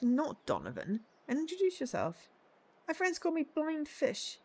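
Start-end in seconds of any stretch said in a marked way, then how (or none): 2.9-4.4 put-on high, fumbling voice of a lost young man; squeaky, befuddled young man hunting his glasses; high confused voice searching for his glasses; muddled young man. Voicing lost short-sighted young man.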